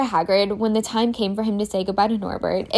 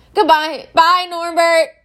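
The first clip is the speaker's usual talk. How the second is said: sobbing; 8 semitones higher, 7 dB louder, slower than usual. A narrator's reading. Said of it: "Hagrid sobbed".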